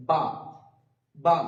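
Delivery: বা with both lips pressed and released, no puff of air; ba demo. Shown as a demonstration. Teacher demonstrates বা to students, lips meeting. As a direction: pop the lips on বা. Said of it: "দুটো ঠোঁট একসাথে মিলিত হওয়ার পরে ছেড়ে যাবে".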